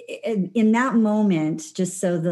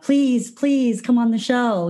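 slow, higher begging please please; mock pleading; 6 semitones higher, 3 dB louder, slower than usual. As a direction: beg playfully, drawing it out. Retold pleading to a guest.

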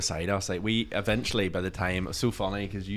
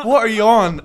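higher, louder, laughing through it; laughing retort; 11 semitones higher, 12 dB louder than usual. Retold or imitated. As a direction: fire it back while cracking up. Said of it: "And I went".

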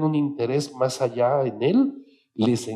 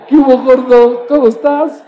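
high, loud friendly greeting; friendly call; 11 semitones higher, 14 dB louder than usual. Retold or imitated. Friends greeting the chubby boy, loud and high.

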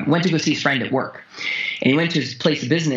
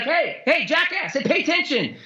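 mock call-out; 11 semitones higher than usual. Voicing pain calling him out.

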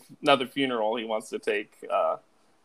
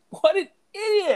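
mock scorn; 5 dB louder than usual. Mocking the movie character.

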